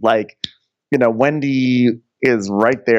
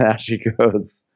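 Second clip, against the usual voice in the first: ordinary delivery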